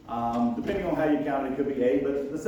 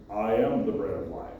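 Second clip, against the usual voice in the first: lower, booming mock voice of God; 8 semitones lower than usual. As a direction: play God, deep and solemn with a wink. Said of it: "That was my best God voice".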